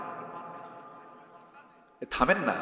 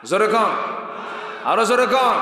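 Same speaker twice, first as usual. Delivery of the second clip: loud, raised crowd-rousing call; urging louder; 6 semitones higher, 4 dB louder than usual. Real, not acted. Preacher demanding a louder answer, loudly urging. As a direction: push the crowd, louder each time.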